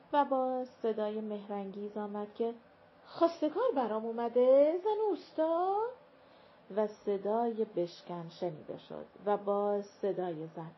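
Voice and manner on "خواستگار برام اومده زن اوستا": thrilled excited question. A narrator's reading. Girl thrilled about a suitor.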